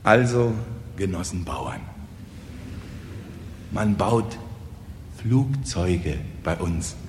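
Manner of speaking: halting and tentative, with long pauses; embarrassed. Acted. Kosonossow begins his speech afresh, embarrassed and hesitant. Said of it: "setzte verlegen das eine Bein".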